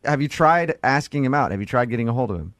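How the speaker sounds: ordinary delivery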